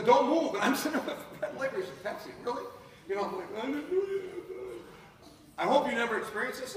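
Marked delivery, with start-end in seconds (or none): 0.0-0.5 loud, higher command; cop's command. Retold or imitated. The cop holds a gun to his face, loud command. 3.6-4.8 scared plea. Retold or imitated. Pleading with the police.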